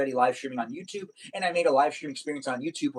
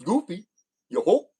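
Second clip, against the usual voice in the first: Goofy voice; 4 semitones higher than usual. Shown as a demonstration. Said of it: "I can do Goofy".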